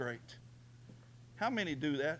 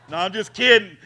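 laughing denial; 4 semitones higher, 15 dB louder than usual. Real, not acted. Joking denial to congregation.

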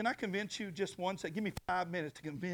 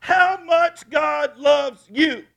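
loud, high, very slow shout; slow preached shout; 11 semitones higher, 15 dB louder, much slower than usual. Acted. Pastor repeating his point even slower, shouting. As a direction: stretch every word out.

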